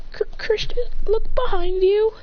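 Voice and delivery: acted scared stammer. A narrator's reading. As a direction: warn them nervously, haltingly. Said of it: "Chloe and Go are scared".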